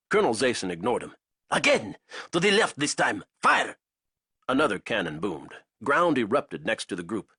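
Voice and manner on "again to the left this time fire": barked order. Acted. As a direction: command the gunners with force. Colonel ordering cannon fire.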